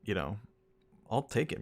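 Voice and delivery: usual speaking voice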